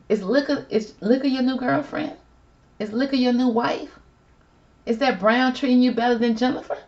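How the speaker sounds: high, incredulous teasing questions; mock interrogation. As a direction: quiz him in a high voice, each question rising. Mock interrogation of Ben about drinking.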